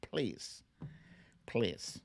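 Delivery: ordinary talk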